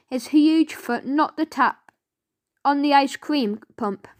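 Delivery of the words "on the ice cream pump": ordinary delivery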